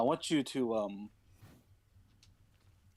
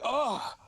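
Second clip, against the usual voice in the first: mock Cain cry; 13 semitones higher, 5 dB louder than usual. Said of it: "Cain's like".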